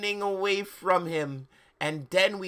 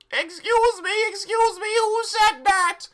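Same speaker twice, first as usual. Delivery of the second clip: very high, loud, slow demand; high-pitched outraged yell; 7 dB louder than usual. Acted. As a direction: yell it in a squeaky put-on voice. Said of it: "yelled Mister Norris".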